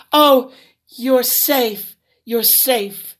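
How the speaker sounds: slow, relieved mother; a mother sobbing with relief; tears of joy. Acted. Mother finds her lost daughter, slow and overjoyed. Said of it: "she cried tears of joy".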